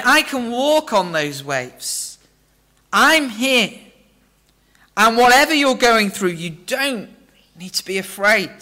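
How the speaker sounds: Jesus reassuring. Acted. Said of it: "Jesus says to us".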